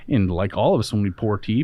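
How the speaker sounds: ordinary talk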